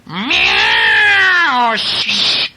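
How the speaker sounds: loud, high meow and hiss; frightened cat. Acted. Reader acts out the frightened cat for a child, high yowl.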